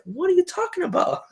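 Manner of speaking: very high, laughing quote; high mock Comey. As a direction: squeak it in disbelief. Comey quoted, much higher.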